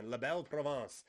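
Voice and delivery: a hammed-up, clumsy French accent; awful French accent. Wrestling podcaster naming Montreal's province, in a bad French accent. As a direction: roll out the Quebec nickname in a bad French accent. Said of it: "that was an awful French accent".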